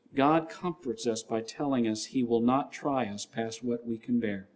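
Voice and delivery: usual speaking voice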